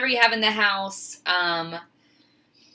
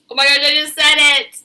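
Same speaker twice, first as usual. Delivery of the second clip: loud, high, fast exclamation of surprise; louder, higher startled self-catch; caught herself; 8 semitones higher, 11 dB louder, faster than usual. Real, not acted. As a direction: burst out as you catch your own habit. Catches herself saying okay again.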